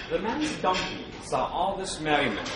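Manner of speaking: usual speaking voice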